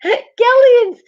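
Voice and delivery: high, loud shriek of the name; high-pitched, amused outcry; laughing exclamation. Real, not acted. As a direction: burst out of laughter into a squeal. Exclaims at a chat comment.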